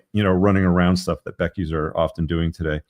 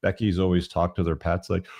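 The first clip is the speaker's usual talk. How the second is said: ordinary talk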